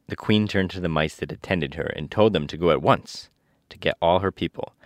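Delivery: ordinary delivery, nothing marked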